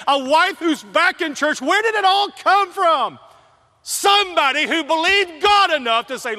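loud, high, fervent preacher voice; preaching at full force. Acted. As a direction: preach it like the story is happening now. Builds to a preaching climax.

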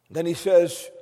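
ordinary talk

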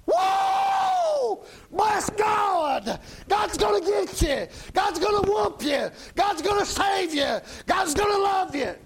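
mock hellfire preacher yelling at the congregation; fire-and-brimstone preacher. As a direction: bellow it like a preacher squared up for a fight. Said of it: "gets back in a fighting stance".

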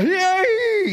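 high-pitched, drawn-out squeal of "yay"; freaking-out cheer. Acted. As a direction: imitate disciples going wild in a high voice. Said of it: "they start freaking out".

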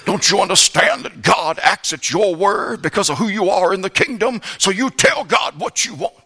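lightly lampoon a preachy TV evangelist; mocking televangelist. Mocking televangelists telling believers to order God around, lightly voiced. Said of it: "at these televangelists".

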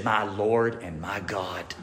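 low, soft and slow, Thomas on his knees; hushed awe. Retold or imitated. Thomas falling down before Jesus, hushed awe. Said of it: "he fell down and he said".

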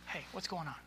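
soft, quiet check-in; gentle, on their level. Shown as a demonstration. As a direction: ask a kid gently, without any threat. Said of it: "Get on their level".

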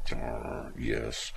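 low, soft grudging yes; reluctant admission. Retold or imitated. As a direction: mumble it as if hating to admit it. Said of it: "They don't like admitting it".

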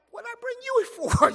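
put-on high, loud little-kid squeak; boy balking at his uncle's order; protesting little boy. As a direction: whine it up high like a startled six-year-old.

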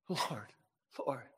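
barely audible whispered plea; broken prayer. Retold or imitated. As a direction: breathe it out, spent. Imitating a weeping, broken prayer.

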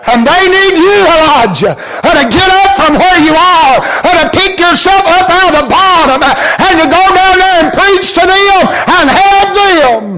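rally him to get up and go; impassioned preaching. Acted. God urging Elijah to get up and preach, impassioned. Voicing God's charge, preaching.

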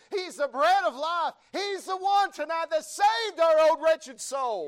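loud, high, driving revival preacher; thundering church proclamation, pitch climbing high; full-force preaching. Real, not acted. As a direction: build to a shout of praise, listing God's names.

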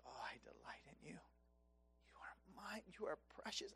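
soft whispered reassurance; God's tender whisper. Acted. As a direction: breathe the words like a loving father. God speaking tenderly to believer.

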